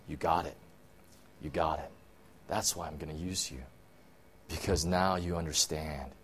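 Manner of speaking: low, soft and tender; hushed tender approval; gentle angel. Acted. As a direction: reassure him quietly. Angel answers Gideon gently.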